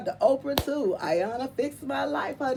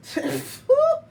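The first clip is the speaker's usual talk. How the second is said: sudden loud high laugh; high laughing squeal; high burst of laughter; 6 dB louder than usual. Real, not acted. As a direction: crack up out loud.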